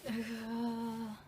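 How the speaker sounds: long sigh while thinking; slow exhale over a hard question. Real, not acted. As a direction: let out a drawn-out pondering sigh.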